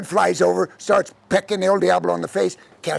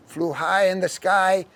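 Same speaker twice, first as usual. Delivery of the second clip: plain reading voice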